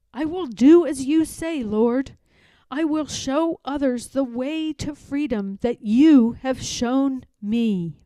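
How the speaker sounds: a firm, fervent offering; Harriet resolved. Acted. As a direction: declare herself ready to the Lord. Harriet vows obedience.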